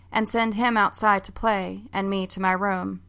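usual speaking voice

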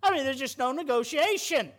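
ordinary talk

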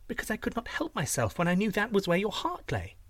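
high, gushing princess voice; doting princess. Acted. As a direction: flatter the giant sweetly, pitched high.

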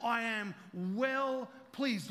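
slow declaration. Acted. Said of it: "God the Father speaks over him and says".

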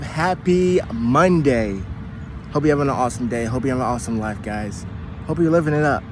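ordinary talk, nothing marked

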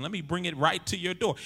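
ordinary delivery

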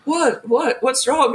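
high, mock just-woken bewilderment; feigned sleepy innocence. Opening the door to police, feigned sleepy innocence. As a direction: imitate someone pretending they slept through it. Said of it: "pretending we're both sound asleep".

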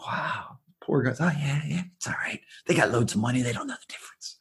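hushed whispered aside; conspiratorial whisper to the interviewer. Real, not acted. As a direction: whisper it like a secret. Joking aside about the rich.

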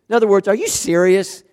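usual speaking voice

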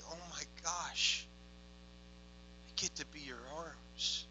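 soft, halting wonder; quiet inner amazement with long pauses; hushed awe. Retold or imitated. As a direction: say it softly to yourself, overwhelmed. Retells awed inner reaction to weeping.

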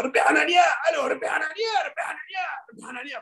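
high shouted calls of the name, fading away; imitating a man yelling at a cocktail party; shouting a name. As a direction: call across the room to someone. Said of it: "Había uno que le gritaba".

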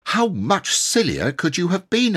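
high, cross retort; crossly. Acted. Boy scolds his siblings, crossly. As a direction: throw it back at them crossly. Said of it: "very crossly indeed".

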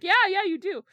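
teasing. Acted. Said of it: "Sirius is like".

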